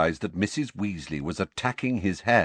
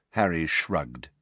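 ordinary delivery

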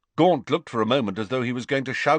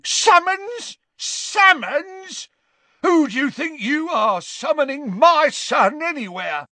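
high, loud outraged shouting; 5 dB louder than usual. Acted. Father outraged at his son being summoned, shouting. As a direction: explode at the very word.

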